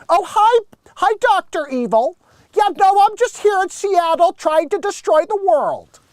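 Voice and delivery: high, loud nerdy Bill Gates voice; Bill Gates impression. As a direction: chirp it like an eager tech nerd. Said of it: "Dead on Bill Gates".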